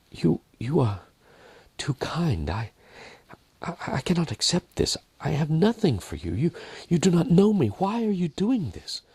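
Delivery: high, cracking voice, stammering through sobs; overwhelmed, fighting back tears; a broken, tearful plea; tearful and choked. Acted. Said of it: "between sobs as her voice cracked with the emotion".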